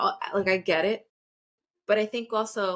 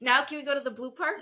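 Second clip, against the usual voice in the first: imitating kid asking; 6 semitones higher, faster than usual. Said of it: "He's like".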